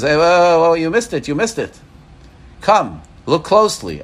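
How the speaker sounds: high, urgent-sounding call, loud at first; excited calling back. Acted. Friend calling you back to the aquarium window, excited call. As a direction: stop a passer-by with an excited exclamation.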